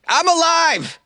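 very high, loud cry of I'm alive; boy shouting in court. Retold or imitated. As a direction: yell it to the whole court. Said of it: "He probably said it loudly".

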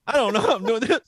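laughing mock weeping; mock crying. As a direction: fight back fake tears. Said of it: "You go".

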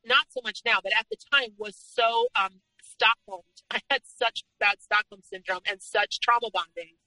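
normal talking voice

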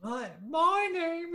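high, drawn-out English accent; put-on English accent. Shown as a demonstration. Showing off the fifth accent.

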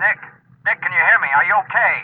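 anxious shout into the phone; calling out. Acted. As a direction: call urgently to the diver below. Calling down to trapped diver.

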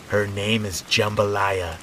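slow, eerie lilt; a hint of a strange old neighbor; creepy old woman. Creepy neighbor shows her doll.

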